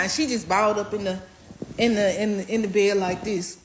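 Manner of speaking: usual speaking voice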